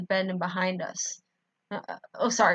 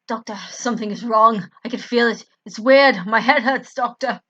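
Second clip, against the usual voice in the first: strained growl; 2 semitones higher, 8 dB louder than usual. A narrator's reading. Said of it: "I growl slightly to him".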